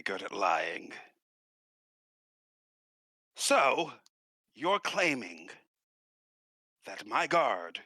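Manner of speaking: slow, deliberate lord with long pauses; sceptical noble questioning guards. Acted. Noble challenges the guards' story about his dead guard, slow and pointed. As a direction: lay out the accusation piece by piece, measured.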